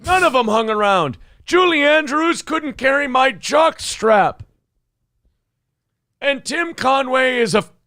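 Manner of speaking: put-on cranky old star trashing co-stars; old entertainer voice.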